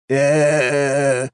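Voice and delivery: long, high stretched "eh" of dismay; drawn out. Acted. Archmage seeing skeletons replace his circle, drawn out. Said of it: "протянул один из архимагов".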